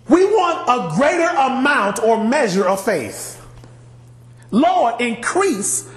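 a touch of begging; pleading apostles. Acted. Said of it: "The apostle said to the Lord".

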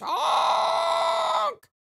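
huge high clown honk; silly clown. Pretend. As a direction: shout the honk like a crazed clown. Voicing the clown character.

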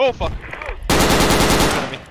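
sudden loud alarmed shout; yelling across the room. Real, not acted. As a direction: bellow the warning to your squad. Spotting enemy in firefight.

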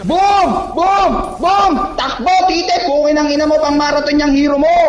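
triumphant taunting shout. Real, not acted. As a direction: shout it in the rival's face. Gamer trash-talking after scoring kill.

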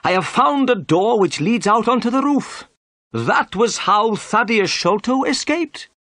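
very high, excited inspector; pompous inspector announcing his discovery loudly; triumphant cry. A narrator's reading. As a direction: cry out in self-satisfied triumph, pitched high.

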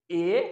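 ए said alone as a sample; vowel demo. Shown as a demonstration. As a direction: model the vowel ए. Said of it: "सिर्फ डंडे दो बूंद हुए हैं".

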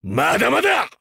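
shouted mid-fight; defiant battle shout. Acted. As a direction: push back up and keep fighting. Battle, refusing to give up.

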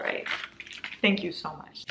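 ordinary talk

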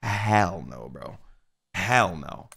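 normal talking voice

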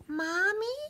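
very high, small asking voice; tiny voice. A narrator's reading. Baby mouse calling for its mother, tiny voice. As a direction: ask like a lost little mouse. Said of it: "said a very small voice".

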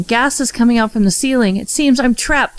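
ordinary talk, nothing marked

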